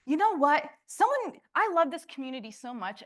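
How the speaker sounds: sudden high, loud gush of enthusiasm; excited outburst. Retold or imitated. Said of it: "I was walking around being like".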